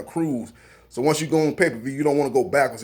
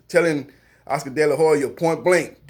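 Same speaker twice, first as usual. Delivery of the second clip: normal talking voice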